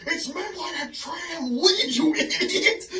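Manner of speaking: high, loud imitated movie yell; irate correction. Future self correcting his younger self, mock-irate yell. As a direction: squawk the correction in exasperated mock outrage. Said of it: "when his future self pops him upside the head and corrects him".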